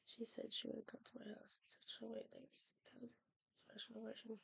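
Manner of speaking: soft unvoiced whisper of the passage. Real, not acted. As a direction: whisper it under your breath.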